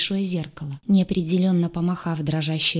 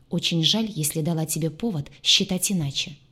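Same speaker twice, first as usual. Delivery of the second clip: usual speaking voice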